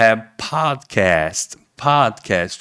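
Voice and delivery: podcast with an open A, dragged out slowly; podcast stretched out in American English. Shown as a demonstration. Teacher repeating the correct podcast to students. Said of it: "tá escrito com A".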